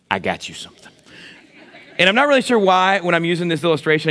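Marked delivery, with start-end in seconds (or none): 0.1-0.9 low, soft seductive murmur; sexy voice. Pretend. Husband teasing his wife about a gift, sexy voice. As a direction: purr it to her like a come-on. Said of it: "I use my sexy voice".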